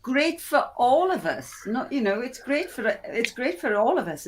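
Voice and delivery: normal talking voice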